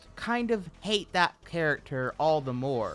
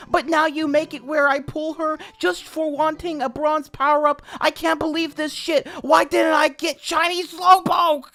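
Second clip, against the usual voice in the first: very high, loud furious whine; mock P L screaming at the devs; mocking ranting gamer; 14 semitones higher, 10 dB louder than usual. Narrator reading P L's rant at Dina, mock high furious whine.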